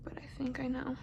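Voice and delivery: conspiratorial whisper. Real, not acted. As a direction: whisper it knowingly. Unvoiced aside.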